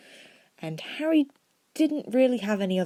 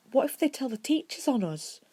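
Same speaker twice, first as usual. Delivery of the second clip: put-on higher, anxious girl; timid worry; 9 semitones higher than usual. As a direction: fret to a friend, voice raised. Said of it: "Hermione asked timidly".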